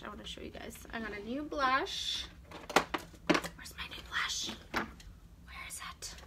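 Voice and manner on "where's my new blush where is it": unvoiced, slow searching whisper; whispering to herself. Real, not acted. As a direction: whisper while rummaging.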